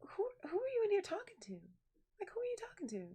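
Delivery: high, incredulous retold question; mock nosy questioning. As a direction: ask it high, playing up the disbelief. Retells nosy questioning of aunt.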